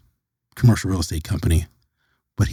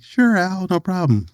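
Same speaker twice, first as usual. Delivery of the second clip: high-pitched, easy yes; agreeable reply; 10 semitones higher than usual. Retold or imitated. Replying to Al's request to come back, high and agreeable. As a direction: pitch it way up, lightly obliging.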